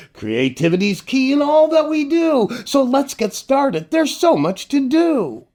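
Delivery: high, bubbly host welcome; sing-song children's host intro; kids' show host. Acted. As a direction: greet the little ones with big cheer.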